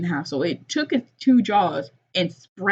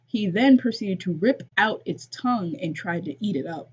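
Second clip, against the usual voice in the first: ordinary delivery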